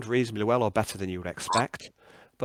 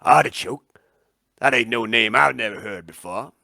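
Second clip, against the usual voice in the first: loud, rustic swamp local; country voice, forcefully delivered; swamp dweller; 7 dB louder than usual. Acted. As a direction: challenge the stranger as a suspicious swamp dweller. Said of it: "and he goes".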